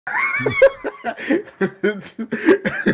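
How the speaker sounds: bright squealing laugh; high laugh. Real, not acted. Laughs at own story.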